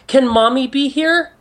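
put-on high-pitched child voice, asking innocently; little kid. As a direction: pitch it way up like a pleading small child. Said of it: "the kid looks up at you".

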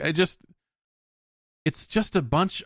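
ordinary talk, nothing marked

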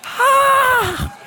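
sharp high cry of exasperation; high frustrated shout. Acted. Preacher voicing Jonah's despair, a high shout. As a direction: yelp it out, way up high.

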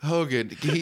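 ordinary talk

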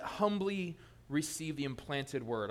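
slow, deliberate reread of humbly receive the implanted word; repeated for emphasis. Shown as a demonstration. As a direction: lean on humbly, let it land. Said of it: "Notice that".

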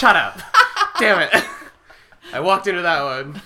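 loud flustered laughing protest; laughing embarrassed. Real, not acted. Caught out by friends teasing him, laughing loudly. Teased about his type, laughing.

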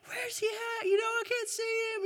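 put-on very high child voice, searching eagerly; kids' high voice. Boys asking Mom where baby Jesus is, very high childlike voice. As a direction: imitate a little boy straining to spot something.

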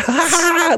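high, gleeful mock cackle; imitated laughing. Retelling an excited director waving from his car, high imitated laughter. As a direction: burst into someone else's delighted laugh. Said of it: "like, laughing".

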